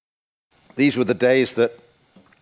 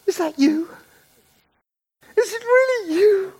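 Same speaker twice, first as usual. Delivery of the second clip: much higher, delighted mock girlish voice; imitating girlfriend on phone. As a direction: squeal with disbelief on the phone. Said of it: "She'd say".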